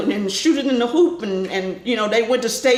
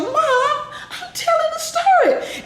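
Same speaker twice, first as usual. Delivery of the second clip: annoyed protest; slower than usual. Retold or imitated. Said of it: "I was like".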